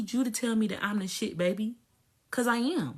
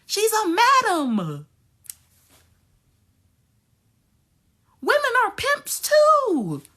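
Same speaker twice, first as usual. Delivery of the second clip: louder, higher, slow stretched yell; slow shout; 8 semitones higher, 8 dB louder, slower than usual. Real, not acted. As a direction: hammer each word in a shout. Ranting outburst.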